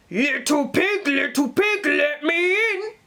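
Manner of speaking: lightly voiced wolf coaxing at the door; wolf calling. Wolf calling to the first pig at his door. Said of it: "he called".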